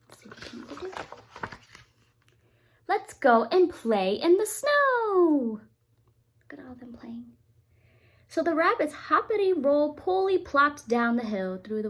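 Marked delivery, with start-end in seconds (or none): 2.9-5.6 slow, loud, high and eager; excited rabbits. Acted. Rabbits inviting everyone to play, loud and eager. 6.4-7.4 hushed aside; whisper. Real, not acted. Parent pointing out the playing rabbits, whispering. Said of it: "aside to child".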